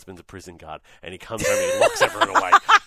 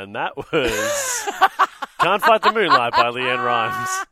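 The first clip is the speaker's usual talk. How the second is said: high, loud, drawn-out song back-announce; radio announcer; 11 semitones higher, 10 dB louder, slower than usual. Retold or imitated. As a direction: announce the track like on air in a cafe. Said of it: "and I go".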